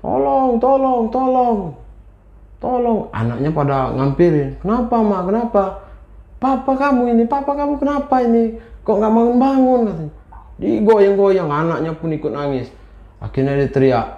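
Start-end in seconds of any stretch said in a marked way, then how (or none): 0.1-3.1 storyteller acting a wife's panicked calls; crying for help. A narrator's reading. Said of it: "Istri ini teriak". 4.6-9.8 high, urgent acted family alarm; storyteller's anxious, high-pitched pleading. A narrator's reading. Acted children and frantic wife.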